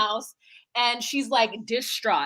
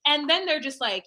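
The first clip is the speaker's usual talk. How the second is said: ordinary delivery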